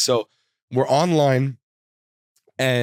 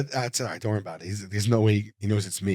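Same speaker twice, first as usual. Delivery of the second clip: soft, low reassuring to a friend; calm him down under your breath; hushed reassurance; 5 semitones lower, 5 dB quieter, faster than usual. Retold or imitated. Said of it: "And I go".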